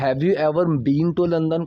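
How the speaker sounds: slow model sentence. Shown as a demonstration. Teacher models slow English line.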